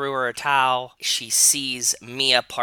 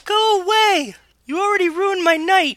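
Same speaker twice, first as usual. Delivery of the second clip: loud, very high put-on girl's shout; angry Ellie falsetto; 7 dB louder than usual. Younger sister shouting through the door, angry falsetto. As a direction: shriek back in falsetto.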